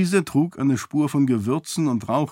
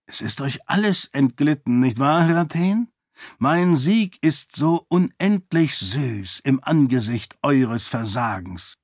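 scornful zealot priest; 2 semitones higher than usual. Acted. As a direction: lean on each word like a fanatic lecturing his superior.

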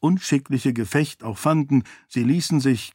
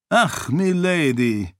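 slow, sighing stage gallant, a touch higher; theatrical voice; 3 semitones higher, slower than usual. A narrator's reading. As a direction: ham it up like a courtly lover on stage. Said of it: "sagte er mit theatralischer Stimme".

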